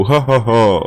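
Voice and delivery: short villainous ha-ha-ha; staged evil laugh; gloating laugh. Acted. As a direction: crow over a victim with laughter. Villain relishing the victim's tears.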